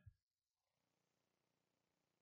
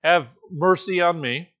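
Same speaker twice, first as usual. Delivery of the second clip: loud, raised call for mercy; crying out; 4 semitones higher, 7 dB louder than usual. Acted. Blind man appealing to Jesus, crying out. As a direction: call out over a crowd. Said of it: "crying out".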